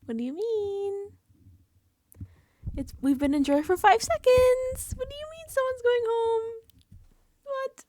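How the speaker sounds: high, loud shrieking disbelief; squealing what-do-you-mean panic; panicked jurors. Retold or imitated. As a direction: shriek it in outraged shock. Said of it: "They're like".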